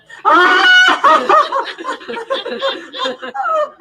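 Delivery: long, high, loud burst of laughter. Real, not acted. Laughing helplessly while telling the story. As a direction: laugh hard at the memory. Recalling meeting the president.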